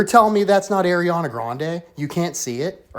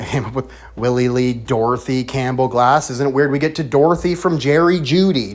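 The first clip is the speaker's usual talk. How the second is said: usual speaking voice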